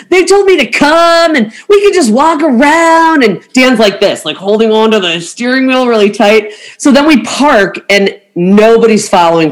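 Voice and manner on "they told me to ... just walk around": lightly voiced cheerful bluff, pitched high; bluffing travel agent. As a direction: sell the guard on it with breezy confidence. Bluffing the gate guard.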